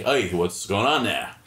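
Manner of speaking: a bartender softening his Boston accent for a stranger; toned-down Boston accent. Shown as a demonstration. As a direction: ease the Boston accent back a notch. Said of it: "you lower it down just a bit".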